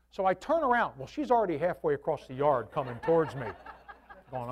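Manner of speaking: ordinary talk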